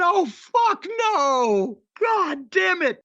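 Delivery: high, drawn-out cry of dismay; exasperated outcry. Retold or imitated. Co-host recalls hearing the wrestler open his mouth, exasperated outcry. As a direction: wail it slowly in mock despair.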